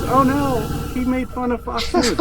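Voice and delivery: mock offended viewer. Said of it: "a bunch of people offended".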